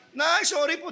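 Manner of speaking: imitating a high, crying shout; mock wailing apology. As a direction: wail it like a mourner at a funeral. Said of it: "sisigaw pa na".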